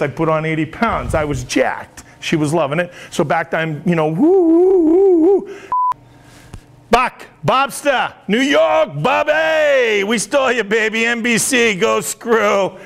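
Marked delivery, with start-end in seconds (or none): 3.9-5.6 high, loud party whoops; whooping. Acted. Weatherman celebrating his hookup story, high loud whoops. 6.9-12.8 hyped shout-out. Acted. Weatherman throwing to anchors Buck and Bob, cocky hype.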